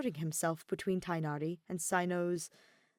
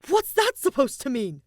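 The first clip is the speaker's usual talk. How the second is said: much louder, higher outraged question; outraged disbelief; 8 semitones higher, 12 dB louder than usual. A narrator's reading. Kaveh outraged at the rent jab, shouting. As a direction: blurt it out, stung. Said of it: "Kaveh's jaw drops open".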